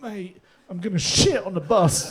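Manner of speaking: slow, high, strained desperate plea. Retold or imitated. Comic pleads with the London bus driver, desperate. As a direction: beg the driver slowly from the gut. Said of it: "no control over, came out of my guts".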